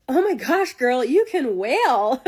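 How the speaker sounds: high, loud, drawn-out gush; hammed-up excited compliment, stretched at the end; gushing admirer. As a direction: imitate an over-the-top fan, belting the praise. Gushing admirer quoted in a story.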